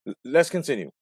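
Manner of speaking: usual speaking voice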